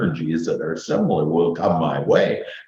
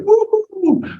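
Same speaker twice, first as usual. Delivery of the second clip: very high whooping woo-hoo-hoo; celebratory woo-hoo. Real, not acted. Speaker celebrating the lucky dental plan, a very high whoop. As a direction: whoop with joy.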